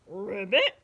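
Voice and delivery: loud ribbit with a rising end; little pig ribbiting. A narrator's reading. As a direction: croak it out, flicking upward at the end. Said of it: "all the little pig said was".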